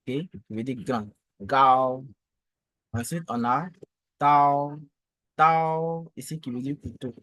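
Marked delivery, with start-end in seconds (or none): 1.4-2.0 "Gao" held on one level note; steady model "Gao" for learners; first tone. Shown as a demonstration. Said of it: "le ton constant, le premier ton". 4.2-6.1 "tao" held on one level note; sustained, even "tao"; first tone. Shown as a demonstration. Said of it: "le ton constant, le premier ton".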